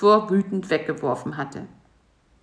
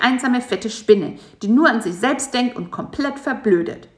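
high, scornful insect voice; 7 semitones higher, 4 dB louder than usual. A narrator's reading. Bisi insulting the fat spider, high and scornful. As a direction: sneer at him, pitched up.

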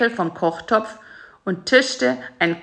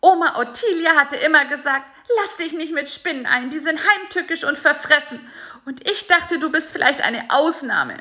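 angry scolding; 8 semitones higher than usual. A narrator's reading. Fly Bisi rants at spider Karl-Heinz, high and furious. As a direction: berate the spider for betraying him.

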